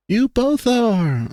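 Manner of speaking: put-on drawn-out haughty put-down from a dopey girl; snooty, dopey. Heather snootily calling both boys gross, dopey and slow. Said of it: "with a snooty flair".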